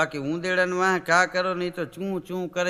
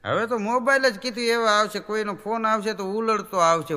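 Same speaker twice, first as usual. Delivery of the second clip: ordinary talk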